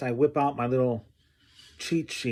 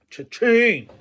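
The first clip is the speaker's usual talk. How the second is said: mock triumph; 9 semitones higher, 7 dB louder than usual. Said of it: "I was like".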